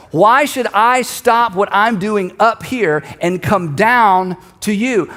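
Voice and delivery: slow, emphatic challenge from above; pointed question. Acted. Nehemiah looks down at the messengers, slow pointed question. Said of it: "He's looking down".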